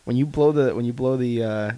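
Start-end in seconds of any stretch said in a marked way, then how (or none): none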